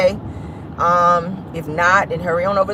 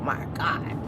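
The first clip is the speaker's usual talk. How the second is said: whispered exasperation; 2 semitones lower, 9 dB quieter than usual. Real, not acted. After road scare.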